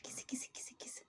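soft unvoiced call of kisa; whispered kitty call. Real, not acted. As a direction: whisper the cat's name over and over.

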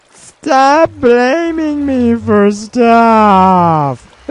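high, drawn-out shout; mock-indignant yell. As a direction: wail it loudly, stretching every word. Mock outburst at being blamed.

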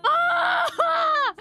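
high, loud shriek of delight; excited scream. Real, not acted. Fan squealing at a big reveal on screen. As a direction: let out a thrilled squeal.

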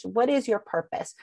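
usual speaking voice

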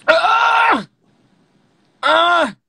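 two piercingly high shouted screams; high loud scream. Real, not acted. Streamer choosing the next guest, sudden high screams. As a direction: scream twice, high and at full volume.

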